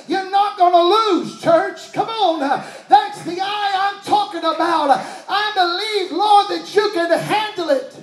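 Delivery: fiery sermon climax; preaching at full force. Acted. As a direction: drive it home, church.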